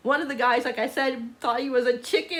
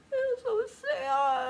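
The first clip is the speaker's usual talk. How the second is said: tearful, rising into a high drawn-out wail; real tearful whine; crying; 9 semitones higher, much slower than usual. Real, not acted. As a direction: choke up and wail in real tears. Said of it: "I'm crying".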